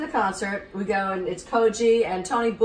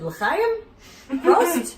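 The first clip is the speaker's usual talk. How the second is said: high, louder toasts; eager guesses; 10 semitones higher, 5 dB louder than usual. Retold or imitated. Guessing toasts for Koji, high and louder. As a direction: try each toast brightly. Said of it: "I said".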